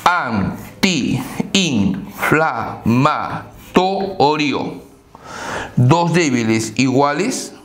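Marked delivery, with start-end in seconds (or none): 0.0-4.9 "antiinflamatorio" drawn out very slowly, both i's apart; slow demo word. Shown as a demonstration. Teacher drawing out an example word for the class. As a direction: spell out "antiinflamatorio" slowly by sound. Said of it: "va a tomar un".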